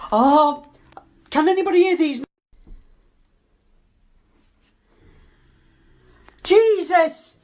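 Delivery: startled, high and loud cry of fright; jolted by a sudden noise, blurting out in fear; frightened outburst. Real, not acted. Alone in a haunted house, she hears a noise and cries out in fright.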